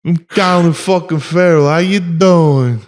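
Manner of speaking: put-on Irish accent, slowing at the end; Colin Farrell. Posing as the actor saying hello, mock Irish accent. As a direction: introduce yourself in a hammed-up Irish brogue. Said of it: "I'm Irish".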